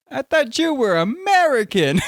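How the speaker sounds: high, loud mock outburst; imitated Vine clip. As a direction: imitate an exclaimed line from a viral clip. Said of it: "one of them was like".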